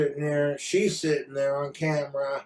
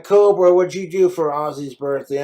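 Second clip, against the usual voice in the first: mock call-out; 2 semitones higher, 9 dB louder than usual. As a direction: yell his name like a fan grabbing his attention. Fan texting him, calling out.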